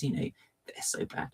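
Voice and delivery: quick guilty whisper; whispered aside. Real, not acted. Streamer admitting energy drinks are bad, whispered. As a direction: whisper the confession.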